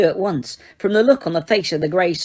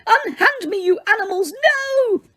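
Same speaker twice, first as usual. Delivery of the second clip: far higher, frantic protest; shrieking. A narrator's reading. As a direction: shriek while being carried off. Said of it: "Umbridge shriek".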